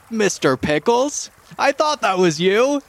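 put-on high-pitched, drawn-out hail to an old friend; delighted recognition. Rock gnome spots an old army friend, delighted recognition.

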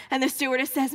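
normal talking voice

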